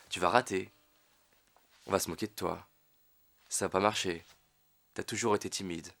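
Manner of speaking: low, hushed voice of self-doubt; little inner voice. Acted. Inner voice sabotaging a timid client, low and quiet. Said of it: "cette petite voix intérieure qui vous dit".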